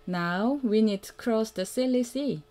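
ordinary delivery, nothing marked

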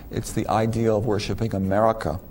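ordinary talk, nothing marked

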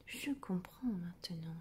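soft whispered murmur. A narrator's reading. Doctor murmuring his diagnosis to himself, whispered. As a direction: murmur it to yourself. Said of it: "murmura le docteur Dodu".

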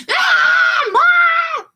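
very high, loud wail for Mom; tattling yell. Pretend. Teacher mimicking a child whose toy got snatched, shrieking yell. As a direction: shriek it like an upset kid. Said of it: "Instead of going".

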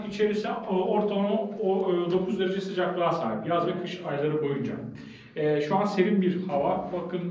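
ordinary talk, nothing marked

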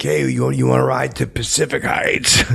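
loud call from a car window. Retold or imitated. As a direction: holler at a jogger passing by. Calling out from car.

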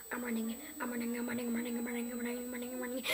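fast breathless whisper, chanting it; scared whispered chant while running; panicked whisper. Real, not acted. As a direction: hurry the words out under your breath. Sneaking through dark, panicked chant.

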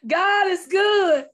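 loud, high joyful praise; praise shout. Real, not acted. Testifying to the church, a loud, high praise shout. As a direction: shout it up to God.

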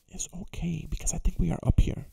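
hushed whisper to himself. Real, not acted. Gamer whispering to himself while searching, hushed. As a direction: breathe it out under your breath.

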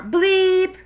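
high, loud, held bleep; saxophone blast. Acted. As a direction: blurt it like one last horn note.

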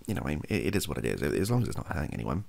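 usual speaking voice

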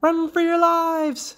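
very high, drawn-out alarm cry; alarmed mother frog. A narrator's reading. Mother to her young by the sleeping snake, high alarm. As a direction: urge escape in a high, stretched voice.

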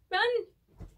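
sudden loud yell of run; shouted warning. Acted. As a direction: bark the warning to flee. Reads shouted warning from book.

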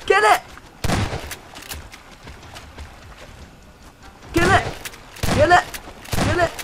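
high urgent yelling; panicked repeated command; being chased. Real, not acted. Monster attack, urgent yelling.

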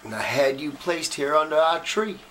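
slow drawl at a graveside, rising in pitch with emotion; grieving Southern simple man. Acted. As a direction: talk slowly and haltingly to a lost loved one. Performs a film monologue.

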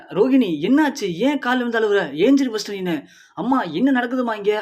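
urgent, lost, demanding answers; alarmed confusion. Acted. Husband seeing his wife fall at his feet, alarmed.

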